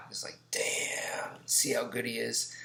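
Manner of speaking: hushed, whispered aside in admiration; awed whisper. Retold or imitated. Students watching their instructor's skill, awed whisper. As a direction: whisper it like an impressed onlooker.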